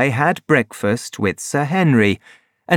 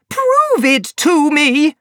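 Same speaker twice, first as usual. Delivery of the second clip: higher, slower, insistent repeat; woman repeating her angry demand, slower and higher; 3 dB louder than usual. A narrator's reading. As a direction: drive the demand home slowly. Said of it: "cried Laura Lyons angrily".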